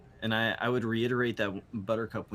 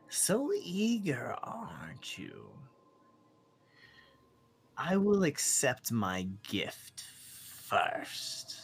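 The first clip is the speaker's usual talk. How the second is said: scheming bird creature; slower than usual. Acted. Bird bargains for its gift first, scheming creature voice. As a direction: savour each phrase, coaxing and superior.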